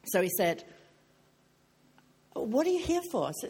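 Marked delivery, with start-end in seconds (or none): 2.3-3.3 high-pitched inquisitive question; curious questioning. Retold or imitated. Imitating the hairdresser asking why she came, curious.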